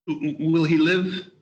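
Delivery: halting, stammered question; fearful stammer. Acted. Scrooge asking the spirit if Tim will live, fearful. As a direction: stumble on the first word, dreading the answer. Scrooge fears for Tiny Tim's life.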